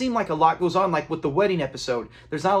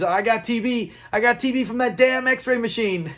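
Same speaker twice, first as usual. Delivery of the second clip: higher-pitched hammed-up outcry; comic alarm, pitched up; mock panic; 6 semitones higher than usual. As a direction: imitate an old man wailing his diagnosis. Acts out Fred panicking over diagnosis.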